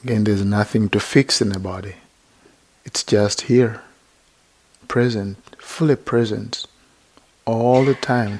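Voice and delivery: unhurried, even-toned meditation teacher; guided meditation. Real, not acted. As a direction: gently guide listeners into body and breath, leaving long silences.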